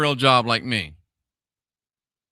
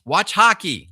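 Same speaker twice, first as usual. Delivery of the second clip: ordinary talk, nothing marked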